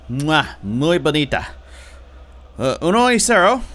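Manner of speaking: kiss the air, then call the score like a Spanish announcer; mock Spanish commentator. Real, not acted. Gamer calling his own goal in Spanish, playful commentator. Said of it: "Don't know why I started speaking Spanish there".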